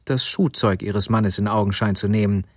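ordinary delivery

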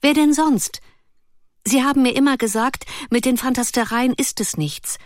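excited Ruth. Acted. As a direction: pour it out like an excited child.

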